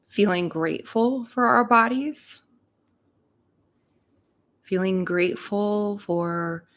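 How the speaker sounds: unhurried meditation voice with long pauses between phrases; guided meditation. Real, not acted. Reverend leading listeners into their hearts and breath, guided meditation. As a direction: guide them gently into breath and gratitude, leaving space after each phrase.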